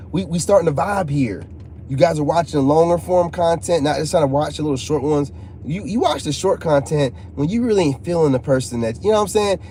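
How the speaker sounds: ordinary talk, nothing marked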